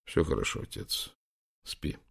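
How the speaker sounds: soft, half-whispered reassurance; hushed soothing. A narrator's reading. Prince to his dying father, softly soothing. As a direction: lull him gently to sleep.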